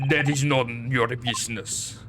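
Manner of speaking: gruff Russian sailor, proud; broken English with a heavy Russian accent. Acted.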